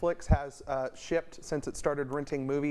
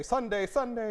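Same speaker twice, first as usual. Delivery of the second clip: higher, booming sports announcer voice; Al Roker announcer voice; 8 semitones higher than usual. Shown as a demonstration. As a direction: sing out the Sundays like a TV weatherman. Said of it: "you were doing a very good job of sounding like an announcer".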